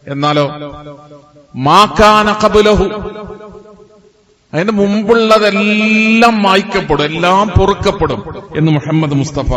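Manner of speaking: high, loud sermon proclamation; fervent preacher driving the promise home; full-force preaching. Acted. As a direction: proclaim it to the congregation, leaning hard on key words.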